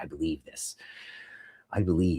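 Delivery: very low, soft declaration; mock-solemn. Fan quoting own earlier declaration, mock-solemn low voice. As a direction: intone it quietly like a creed.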